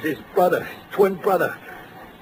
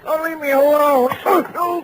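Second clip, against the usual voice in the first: pained protest; 8 semitones higher, 4 dB louder than usual. Acted. Thug roughed up in interrogation.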